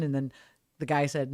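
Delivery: ordinary delivery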